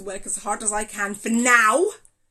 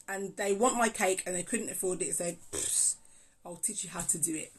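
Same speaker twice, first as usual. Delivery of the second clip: ordinary talk, nothing marked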